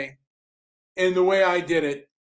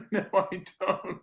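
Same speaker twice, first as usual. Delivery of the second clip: laughing; 9 dB quieter, slower than usual. Real, not acted. Laughing at absurd question.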